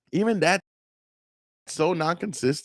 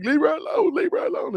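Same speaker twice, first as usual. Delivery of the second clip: high-pitched mocking whine; squeaky, hammed-up plea; mock pleading; 14 semitones higher than usual. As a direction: mimic a protester crying out, way up high. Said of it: "She's all crying, and the guy's like".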